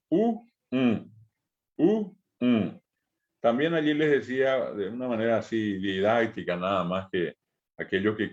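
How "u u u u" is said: U said plainly, then U through the nose; oral and nasal U. Shown as a demonstration. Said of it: "la U oral y la U nasal".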